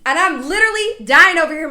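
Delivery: normal talking voice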